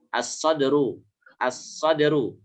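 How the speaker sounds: "As-sadru" with no l, a held doubled s, slowly; assimilated lam. Shown as a demonstration. Teacher models sad for students, "As-sadru" slow with doubled s. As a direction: merge the article into a long s in "As-sadru". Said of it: "Huruf sad adalah syamsiyah".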